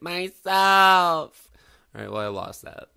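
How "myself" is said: loud, higher squeaky answer; sheepish reply. Retold or imitated. Son caught talking alone, loud squeaky answer. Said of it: "And I'll be like".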